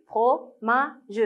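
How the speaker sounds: "fromage" split as fro, ma, ge; "fromage" in three measured beats, final ge sounded; syllable by syllable. Shown as a demonstration. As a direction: read out "fromage" one syllable at a time. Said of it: "La syllabe ma se trouve".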